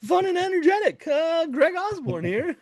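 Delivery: high-pitched hammed-up pastor introducing a guest; mock stage announcement. Greg mimicking pastor Mike calling him on stage, high hammed-up voice. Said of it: "you need me to come up for an announcement".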